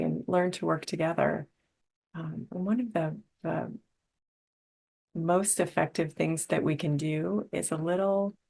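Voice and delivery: normal talking voice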